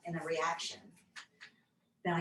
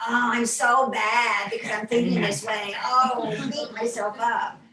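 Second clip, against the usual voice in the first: louder, higher self-scolding voice; hammed-up beating yourself up, louder; mock self-blame; 3 semitones higher, 9 dB louder than usual. Mocking her own harsh inner critic, loud and high. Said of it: "Instead of going".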